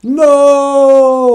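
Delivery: high anguished scream to the sky. Retold or imitated. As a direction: howl it up at the heavens. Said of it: "screaming up at the heavens".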